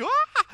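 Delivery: a sudden high, loud yelp of shock; startled cry. Acted. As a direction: cry out as if blinded by light. Saul struck by blinding light.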